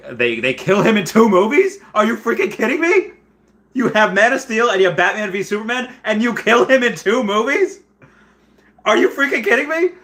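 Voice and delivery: high, loud, incredulous outburst; can't believe they did it, shouting it out; emotional rant. Real, not acted. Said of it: "clearly I'm getting emotional".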